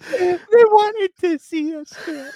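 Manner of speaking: very high, loud, drawn-out squeal; helpless with disbelief, stretching every word; incredulous hilarity. Real, not acted. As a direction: squeal it slowly in amused disbelief. Incredulous laughing at the BBC's reply.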